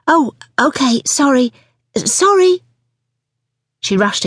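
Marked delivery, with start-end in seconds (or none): 0.1-2.6 high, rising repeated apologies; flustered apology. Acted. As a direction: scramble to apologise to the customer.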